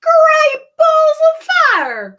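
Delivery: very high, loud, slow cowboy-style cry; a brash goose. Acted. Goose crying out as it flies off. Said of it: "cried the goose".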